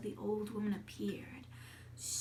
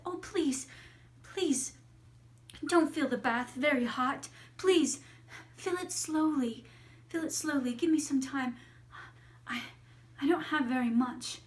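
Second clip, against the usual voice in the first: higher, louder urgent begging; frightened child bargaining for time; pleading little girl; 4 semitones higher, 6 dB louder than usual. Acted. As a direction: beg earnestly, falling on each please. Girl begs the maid.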